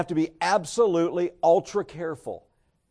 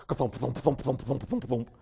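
rhythmic car-trouble thumps; imitated thumping; 2 semitones lower, 4 dB quieter than usual. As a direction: thump it out like a flat tyre. Imitates car thumping after blowout.

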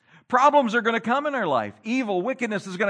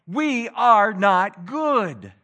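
each word slow and weighty, a touch louder; slow emphatic; 2 semitones higher, 5 dB louder, much slower than usual. Real, not acted. Preacher telling Christians that nobody is good, slow and weighty. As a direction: lean hard on every word. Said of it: "preacher's emphatic confession".

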